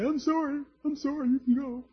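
a hint of a flustered king caving in; mock fainting Pharaoh. Pharaoh imagined apologizing to Moses, high and pleading. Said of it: "fall over in a faint and say".

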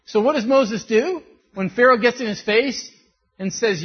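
normal talking voice

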